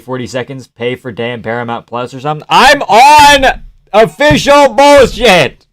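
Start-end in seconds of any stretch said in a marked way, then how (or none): 2.5-5.7 high, drawn-out, slow holler; loud outburst. Real, not acted. Streamer reacting while watching the fight, slow loud holler. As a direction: stretch it out in a raised shout.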